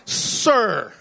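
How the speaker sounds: pointed "Sir." with a chill; lightly voiced offended woman; a touch of icy sir. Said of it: "this is how she answers".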